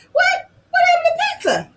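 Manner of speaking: high, loud, fast surprised shout; shocked squeal from the puppet; a squeaky little voice crying out in surprise. Acted.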